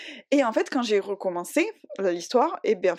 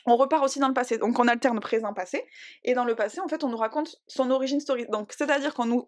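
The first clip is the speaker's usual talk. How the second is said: usual speaking voice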